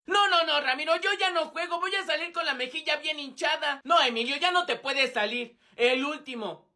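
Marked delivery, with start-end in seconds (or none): none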